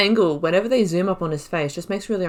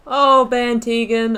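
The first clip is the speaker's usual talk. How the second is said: a louder, slow, drawn-out swoon on the name; fond excitement; 4 semitones higher, 6 dB louder, much slower than usual. Real, not acted. Player gushing over her favourite lord. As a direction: sigh the name like a crush.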